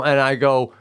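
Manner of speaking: ordinary talk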